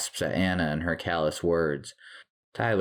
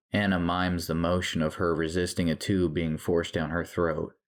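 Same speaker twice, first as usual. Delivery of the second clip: ordinary delivery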